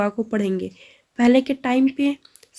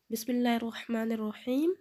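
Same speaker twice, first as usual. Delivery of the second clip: measured recitation, a little softer; recitation for students; 9 dB quieter than usual. Shown as a demonstration. Teacher leading the class in reciting the dua. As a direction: intone the opening carefully for the class. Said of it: "اس کو میرے ساتھ اچھے سے پڑھ لیجئے".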